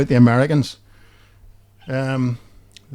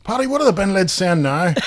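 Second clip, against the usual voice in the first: mock American woman; 6 semitones higher than usual. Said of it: "your woman says".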